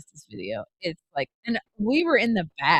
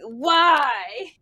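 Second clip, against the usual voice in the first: higher, drawn-out wail of protest; comic despairing cry; mock anguish; 5 semitones higher, 3 dB louder than usual. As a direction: wail it like a wronged rider. Acting out complaint at sudden stop.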